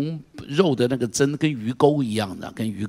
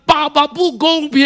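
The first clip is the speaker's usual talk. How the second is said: much higher and louder, slow shout; loud cry to God; 12 semitones higher, 9 dB louder than usual. Shown as a demonstration. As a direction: cry out with all your strength. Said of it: "你可以大声喊".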